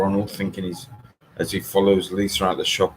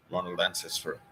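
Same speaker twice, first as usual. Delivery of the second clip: usual speaking voice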